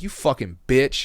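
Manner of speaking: drawn-out indignant swearing, retold; retold angry curse. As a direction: act out cursing a snitch.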